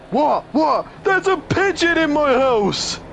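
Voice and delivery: high, loud hammed-up pleading; mock cry for help. Calling co-host Dan for help, high mock-panicked shrieking. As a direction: shriek for Dan like it's a crisis.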